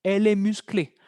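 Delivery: "musclée" ending on a clear é, nothing after; silent final E. Shown as a demonstration. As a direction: model "musclée" for learners, extra E mute. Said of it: "pero es muda".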